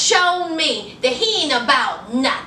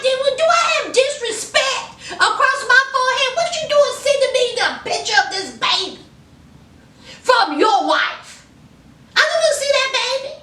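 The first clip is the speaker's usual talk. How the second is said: high, fast, outraged tirade with a laugh breaking in; indignant mock rant; 8 semitones higher, faster than usual. What Megan should tell Michael about the baby picture, high, fast, indignant. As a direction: fire it off high and fast like you're insulted. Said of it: "Megan, you should be like".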